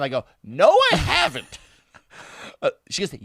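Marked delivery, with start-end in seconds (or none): 0.4-1.6 much higher, protesting retort; insistent mock denial. Storyteller denying her accusation once more, high-pitched protest. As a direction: imitate yourself protesting, pitch shooting up. Escalated denial in retold argument.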